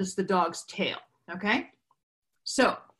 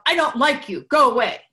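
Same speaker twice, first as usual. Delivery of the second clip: put-on a little girl's furious, louder outburst; girl yelling at her mother over the dog; angry yell; 4 semitones higher, 6 dB louder than usual. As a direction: shout it out like a cross child. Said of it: "And she yelled".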